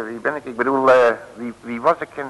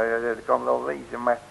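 normal talking voice

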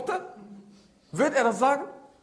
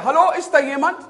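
raised, louder questioning call; 8 semitones higher, 9 dB louder than usual. Acted. Hunter hears noises in the forest, calling out. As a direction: call into the woods for whoever is there.

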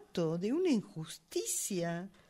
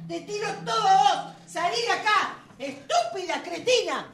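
furious outburst; 10 semitones higher, 10 dB louder than usual. Acted. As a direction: explode with rage at the clerk. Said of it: "La sangre hirviendo se me agolpó".

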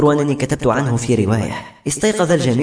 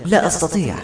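mildly assured, higher-pitched refusal; confident; 6 semitones higher than usual. A narrator's reading. Habiba refusing to hand over the book, confident. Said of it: "قالت بصوت واثق".

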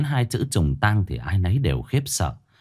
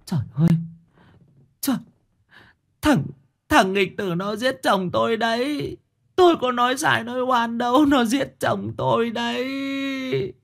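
shrieking wail. A narrator's reading. Widow accuses the wicked son of killing her husband, shrieking wail. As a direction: howl in grief and accusation, stretching the words. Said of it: "Bà Linh rú lên".

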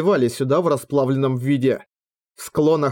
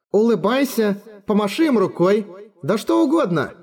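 higher-pitched voice for the dragon's advice; advising dragon; 7 semitones higher than usual. A narrator's reading. Sapphira advises her nervous rider how to greet the crowd, higher-pitched advising. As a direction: offer advice in a lifted, higher voice. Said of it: "посоветовала она".

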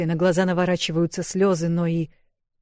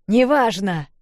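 yelling; 3 semitones higher, 6 dB louder, slower than usual. A narrator's reading. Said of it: "орёт бородатый".

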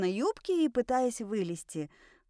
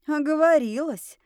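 higher, louder, mildly sullen retort; 4 semitones higher, 6 dB louder than usual. A narrator's reading. Heroine concedes a slip of the tongue, sullenly. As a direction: retort with a touch of gloom. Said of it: "хмуро отозвалась я".

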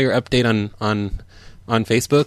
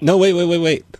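urgent pleading; 6 semitones higher, 4 dB louder than usual. Pretend. Pleading she not hang up.

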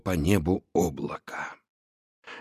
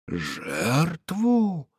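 surprised; 5 semitones higher than usual. Acted. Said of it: "распахнула глаза Сказка".

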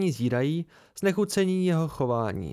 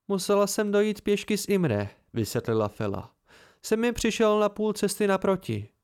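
higher, lightly voiced Fela; a lightly raised female voice; young woman's voice; 6 semitones higher than usual.

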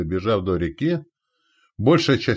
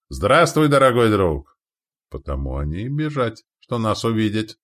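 high, loud, hearty greeting; foreign warrior ally; 3 semitones higher, 4 dB louder than usual. Acted. Ally greeting commander, hearty foreign warrior. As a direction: hail a friend in broken Russian.

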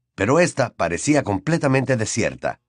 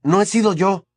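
higher-voiced boy, firmly; firm denial; 9 semitones higher, 3 dB louder than usual. A narrator's reading. Accused nephew denying the noise to his uncle, firmly. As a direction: deny it firmly in a higher, youthful pitch. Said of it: "dijo Harry con firmeza".

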